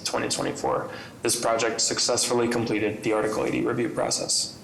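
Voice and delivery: ordinary delivery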